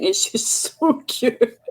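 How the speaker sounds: ordinary delivery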